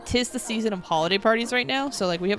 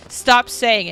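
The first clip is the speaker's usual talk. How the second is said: louder, stern classroom order; firm teacher rebuke; 4 semitones higher, 8 dB louder, slower than usual. Retold or imitated. Teacher scolding a sixteen-year-old student, loud and stern. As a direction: shut down a cheeky student, firmly. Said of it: "And he was like".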